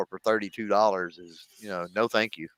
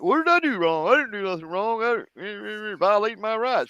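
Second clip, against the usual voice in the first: higher, whiny mock indignation; huffy protest; 10 semitones higher than usual. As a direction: play the indignant suspect protesting innocence. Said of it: "got all huffy".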